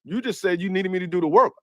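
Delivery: usual speaking voice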